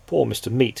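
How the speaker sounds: ordinary delivery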